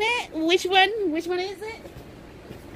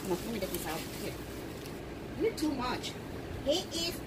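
soft aside; 5 semitones lower, 14 dB quieter, faster than usual. Real, not acted. Muttering while unpacking.

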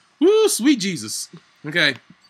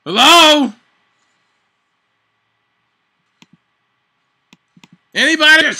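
sarcastic calling out; 6 semitones higher, 9 dB louder than usual. Real, not acted. Calling for teammates' help.

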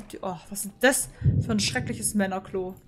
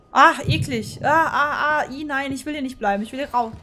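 scared protest; 6 dB louder than usual. Real, not acted. Scared in horror game.

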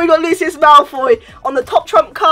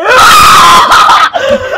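loud laugh; 11 semitones higher, 11 dB louder than usual. Real, not acted.

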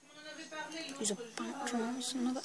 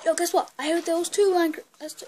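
excited; 7 semitones higher, 7 dB louder, faster than usual. Real, not acted. Excited news to viewers.

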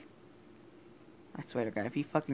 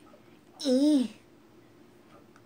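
loud laugh; 13 semitones higher, 12 dB louder than usual. Real, not acted. Laughing at own weird noises.

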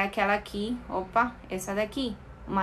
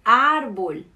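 Árbol with a loud, long first Á; ÁR-bol, as a corrected model word; stress on first syllable; 7 dB louder than usual. Shown as a demonstration. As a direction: hit the Á of Árbol hard, then fall away. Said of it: "o A tem a tilde".

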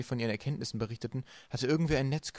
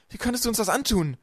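high, loud reproach; shouting upward; 11 semitones higher, 7 dB louder than usual. A narrator's reading. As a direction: cry it up at your betrayer. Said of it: "rief Klaus nach oben". (laut und hoch, vorwurfsvoll gerufen)

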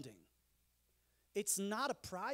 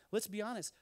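usual speaking voice

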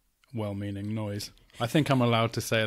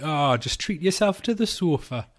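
badly faked Geordie accent, pitched up and louder; awful Geordie; 6 semitones higher, 6 dB louder than usual. Geordies decoded from TV static, awful put-on Geordie accent. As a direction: do a terrible mock Geordie. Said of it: "That's an awful accent".

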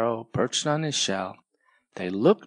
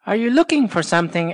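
fast, higher, louder chirpy bird voice; Sparrow asking; 8 semitones higher, 5 dB louder, much faster than usual. Acted. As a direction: dart in with a quick question. Sparrow asks quickly.

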